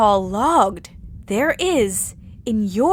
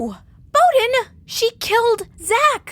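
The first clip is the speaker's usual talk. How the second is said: outraged protest; 12 semitones higher than usual. Acted. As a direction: cry out in disbelief.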